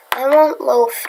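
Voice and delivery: ordinary delivery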